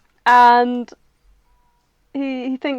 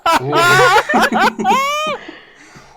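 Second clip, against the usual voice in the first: high, loud burst of laughter; real helpless cackling laugh; 12 semitones higher, 11 dB louder than usual. Real, not acted. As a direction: crack up loudly. Bursts out laughing after the joke.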